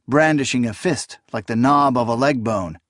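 ordinary delivery

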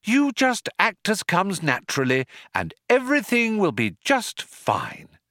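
high, bouncing sing-song; over-bright, soothing reassurance; lilting. A narrator's reading. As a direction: lilt on with forced cheer. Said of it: "the lilt continued".

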